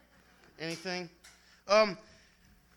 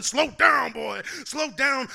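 loud, slow shouted command; yelling slow down, drawn out; mock yell; 4 semitones higher, 8 dB louder, much slower than usual. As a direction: bellow it like the crowd yelling. Crowd yelling at the poet.

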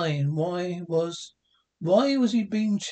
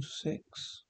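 whisper; 13 dB quieter than usual. Acted. Whispered name.

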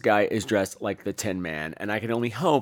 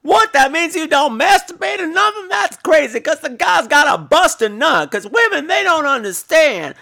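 loud incredulous rant; 14 semitones higher, 5 dB louder than usual. Retold or imitated. Tin Man ranting about wife.

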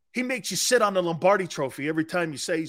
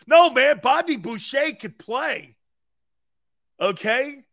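high, loud, slower emphatic protest; excited protest; 8 semitones higher, 6 dB louder, slower than usual. Real, not acted. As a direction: insist loudly, almost laughing. Excited defense of character.

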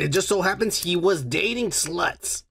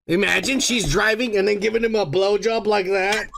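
ordinary talk

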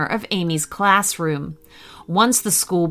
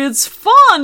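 very high, loud gleeful fun; high, loud delighted dinosaur; cheery dinosaur; 11 semitones higher, 7 dB louder than usual. Acted. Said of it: "said the dinosaur cheerily".

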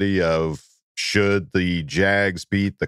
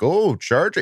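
light imitation of a Canadian Michigan accent, pitched up; hint of Canadian Michigan lilt, higher; 8 semitones higher than usual. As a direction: mimic a friendly Canadian Michigan greeting. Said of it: "he sounded like a Canadian Michigan".

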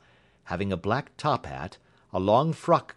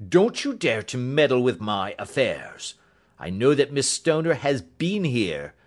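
forceful, emphatic warning; angry threat; 2 semitones higher, 4 dB louder than usual. Acted. Roylott warns Holmes not to meddle, angry threat. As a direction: warn them off with heavy force.

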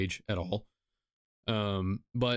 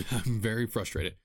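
normal talking voice

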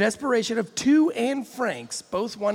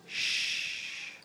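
shushing; 9 dB quieter than usual. Acted. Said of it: "I'm gonna read it in his voice".

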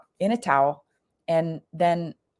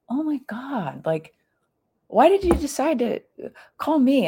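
shocked disbelief; 8 semitones higher than usual. Retold or imitated. Shocked friend phoning after years.

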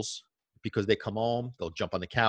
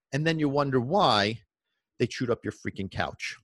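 ordinary delivery

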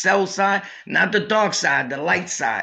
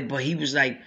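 ordinary talk

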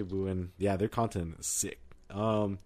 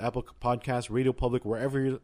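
usual speaking voice

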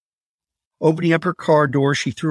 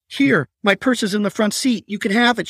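high, frightened pleading; desperate high plea to the attacker; 7 semitones higher than usual. A narrator's reading. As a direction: beg for your life. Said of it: "she pleaded".

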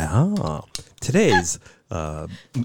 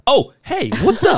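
bright host greeting; 12 semitones higher, 3 dB louder than usual. Pretend. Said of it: "how do I usually do this".

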